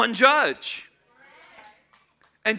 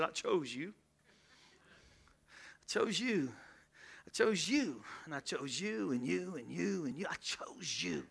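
much softer, lower, tender; intimate hush; 4 semitones lower, 15 dB quieter than usual. Acted. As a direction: whisper it to each one personally. God whispering to each person.

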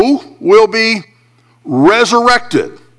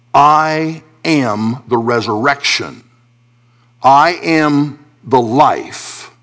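slow, weighty, reverent reading; solemn scripture quote; 5 semitones lower, slower than usual. Acted. Pastor quoting Jesus to his grieving congregation, slow and solemn. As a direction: let each word land slowly, with awe.

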